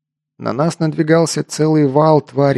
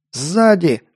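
raised, higher-pitched warning cry; mild yelled warning; 5 semitones higher than usual. A narrator's reading. Лок warns his comrades of danger behind, mild yell. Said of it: "заорал Лок".

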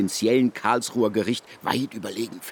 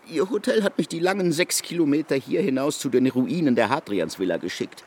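ordinary talk

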